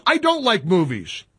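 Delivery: ordinary delivery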